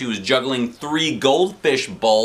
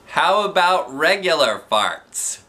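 put-on kid voice; 6 semitones higher, 3 dB louder, slower than usual. A narrator's reading.

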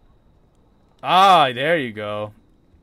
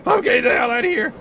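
panicked yell; 8 semitones higher, 7 dB louder than usual. Real, not acted. Panicking in horror game.

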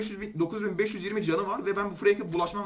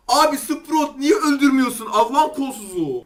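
louder, higher and slow, fed-up cry at a useless teammate; exasperated yell; 5 semitones higher, 6 dB louder, slower than usual. Real, not acted. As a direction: let it burst out in disbelief, drawn out. Frustrated at teammate Sprout. (takım arkadaşına isyan eden, yüksek ve yavaş bir bağırış)

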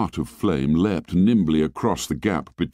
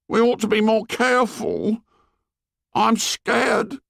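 put-on high, nervous fat boy voice; fretful and frightened; scared Piggy; 12 semitones higher than usual. A narrator's reading.

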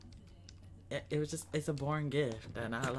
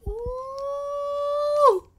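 high squeal; 10 dB louder than usual. Real, not acted.

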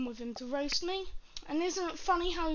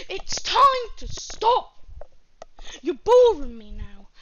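telling them off; 10 dB louder than usual. Real, not acted. Telling rivals to stop.

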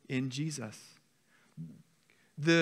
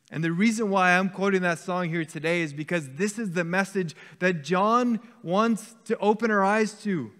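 higher, louder urgent preaching voice; full-force preaching; 4 semitones higher, 6 dB louder than usual. Acted. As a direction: preach it out, pressing the church not to wait.

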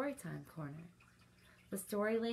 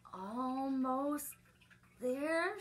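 straining reach; 7 semitones higher, much slower than usual. Acted. Reaching for a high shelf.

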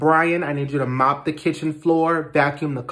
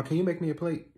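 ordinary talk, nothing marked

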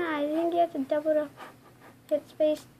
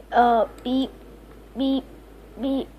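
voiced car horn beeps; playful honk sounds; mock horn beeps. Real, not acted. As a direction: beep like a horn.

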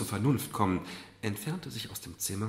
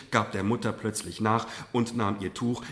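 usual speaking voice

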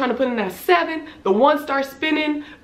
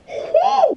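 panicked whoo. Retold or imitated. Panicking in the squishing box.

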